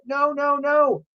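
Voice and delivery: very high despairing cry. A narrator's reading. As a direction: watch it happen helplessly.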